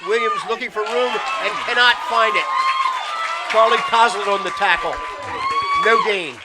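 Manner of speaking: loud, punchy live football call; forceful play-by-play. Real, not acted. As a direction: call the tackle over the crowd.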